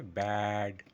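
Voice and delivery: ব্যাড with a long, held vowel; stretched BAD. Shown as a demonstration. Teacher telling learners how to say it, stretched ব্যাড. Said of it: "আমাকে stretch করতে হবে".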